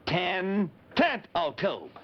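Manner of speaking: Ten tent o' tube drawn out, every syllable apart; said slowly. Shown as a demonstration. Spelling the name out for the kids, slowly. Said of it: "listen to it slowly".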